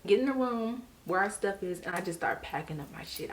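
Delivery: ordinary talk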